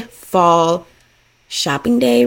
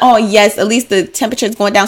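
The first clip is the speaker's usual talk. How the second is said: ordinary talk, nothing marked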